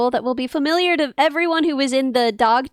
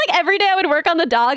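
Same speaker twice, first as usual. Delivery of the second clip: ordinary delivery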